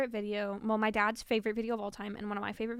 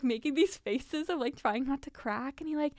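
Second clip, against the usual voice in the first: ordinary talk, nothing marked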